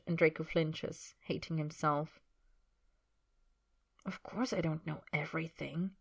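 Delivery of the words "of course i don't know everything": quiet. Acted. Man answers his sulking companion, quiet and soft. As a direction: concede it gently under your voice. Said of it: "Harry says quietly".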